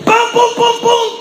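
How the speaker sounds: bang out the knocks; imitated frantic knocking.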